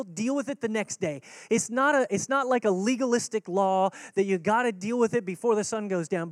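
normal talking voice

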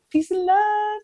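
high, chirpy "Peace and love."; sing-song catchphrase. Real, not acted. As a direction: sing it out like a signature sign-off. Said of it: "I always say peace and love".

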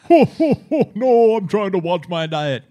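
high, loud Santa voice; fussy Santa turning down cookies. Pretend. Santa replying to elf.

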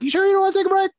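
high-pitched quoted question; mock engineer checking in, very high; concerned engineer. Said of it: "even the engineer was like".

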